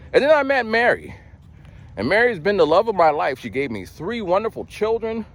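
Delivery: high-pitched gushing happy ending; mock gushing. Imitating someone ending their story with meeting Mary, mock gushing. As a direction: gush it like a testimonial, mockingly upbeat.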